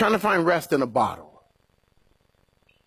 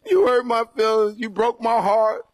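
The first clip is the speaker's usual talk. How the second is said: mock hurt lover; 7 semitones higher than usual.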